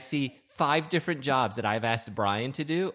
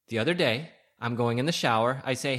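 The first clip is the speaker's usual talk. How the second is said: ordinary delivery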